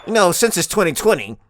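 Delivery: ordinary talk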